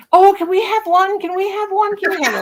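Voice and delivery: put-on high eager little-kid voices; mock kids pleading for a puppy; kids begging. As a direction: beg like excited children.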